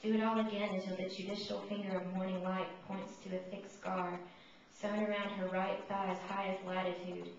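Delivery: measured, solemn poem reading; poetry reading. Acted. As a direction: read it like a poet at a mic. Reads a second poem.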